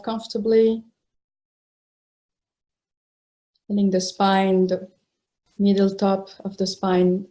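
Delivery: unhurried phrases with long, settling pauses; guided meditation. Real, not acted. Teacher settling meditators into a comfortable posture, unhurried guided meditation. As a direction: guide them into stillness, leaving space between phrases.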